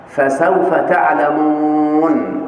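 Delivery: slow, chanted recitation; slow tajwid-style chant; Quran recitation. Shown as a demonstration. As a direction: recite the verse, drawn out slowly. Teacher quotes a Quran verse.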